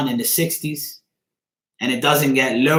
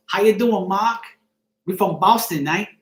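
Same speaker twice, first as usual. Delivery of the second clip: higher-pitched mock Boston accent; 6 semitones higher than usual. Shown as a demonstration. Bostonians greeting Mark, imitated Boston accent. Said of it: "That's how they talk".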